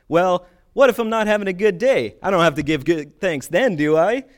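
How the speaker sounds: loud, high, hammed-up objection; confused questioner. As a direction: play someone looking for a loophole. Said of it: "I'm confused".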